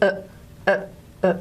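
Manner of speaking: "uh" repeated as short, separate neutral vowels; short schwa. Shown as a demonstration. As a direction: say each "uh" nice and short. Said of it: "nice and short".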